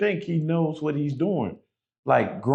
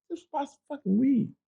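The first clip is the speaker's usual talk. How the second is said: incredulous outburst; 12 semitones higher, 4 dB quieter, faster than usual. Retold or imitated. Outraged at court hearing.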